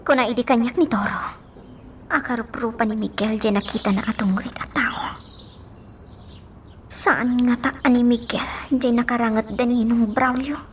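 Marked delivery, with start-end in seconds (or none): 2.1-8.7 hushed unvoiced whisper; secretive whisper to her helper. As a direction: whisper it so nobody overhears. Secret suspicion about Miguel.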